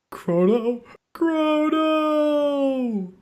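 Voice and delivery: high, drawn-out cry of the name; anguished shout. Acted. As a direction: scream the hero's name. Calling the hero.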